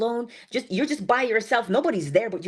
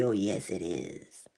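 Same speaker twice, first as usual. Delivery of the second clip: much lower, slow and softer; relishing the memory of the cake, lower and slow; savoring; 12 semitones lower, 7 dB quieter, slower than usual. Real, not acted. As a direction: purr it with satisfaction. Savoring her own cake.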